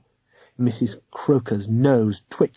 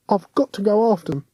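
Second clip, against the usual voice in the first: put-on raised boyish voice, earnest; young cockney boy; 8 semitones higher, faster than usual. A narrator's reading. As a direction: plead as a young London lad. William's voice again.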